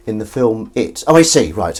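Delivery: ordinary delivery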